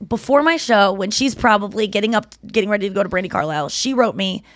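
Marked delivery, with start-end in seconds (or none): none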